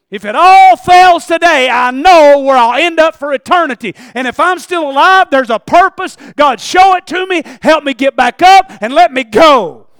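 preaching at full force. Real, not acted.

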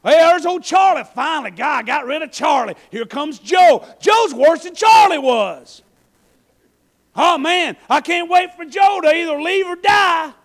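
loud, high, fiery sermon voice; full-force preaching. Acted. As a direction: preach it out to the back row.

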